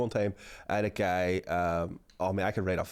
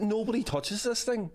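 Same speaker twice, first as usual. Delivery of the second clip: mock possessive; 10 semitones higher, slower than usual. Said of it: "I said".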